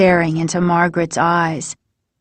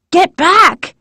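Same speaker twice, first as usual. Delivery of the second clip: much higher, desperate yell; repeated warning; 10 semitones higher than usual. A narrator's reading. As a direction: shout them back again in panic. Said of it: "she repeated".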